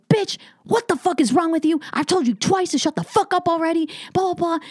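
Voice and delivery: imitating an irate heckled comic, pitched high; high-pitched retold tirade at a woman; going off the rails. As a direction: act out his outburst, voice jumping up. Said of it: "going off the rails".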